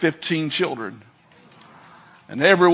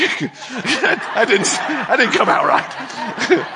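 high, slow, laughing through the words; laughing at his slip; 7 semitones higher, much slower than usual. Real, not acted. Preacher laughing at his own slip, high. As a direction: chuckle through the correction.

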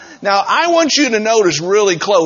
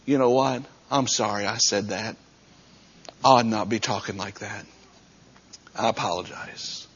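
soft, low, contrite tone; humble apology; 8 semitones lower, 9 dB quieter than usual. Acted. Worker apologizing to a coworker for gossiping, humble apology. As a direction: lower your voice and own up to it.

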